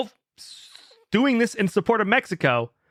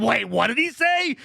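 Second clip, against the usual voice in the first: higher, drawn-out disbelief; startled double take; 7 semitones higher than usual. Retold or imitated. Listener retelling the moment the insult registered, mock-startled disbelief. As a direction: re-enact a shocked realization. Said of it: "I was like".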